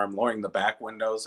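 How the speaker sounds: normal talking voice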